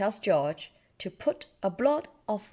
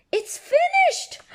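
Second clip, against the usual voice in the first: very high, loud, slow cry; excited Peppa; 7 dB louder than usual. Acted. Peppa seeing the finished house, high squeal. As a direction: squeal with delight.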